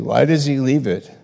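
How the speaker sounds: normal talking voice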